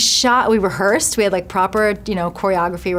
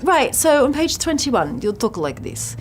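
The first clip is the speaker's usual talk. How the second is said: mock British coach; 6 semitones higher, slower than usual. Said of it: "So she'd be like".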